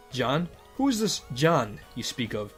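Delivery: ordinary talk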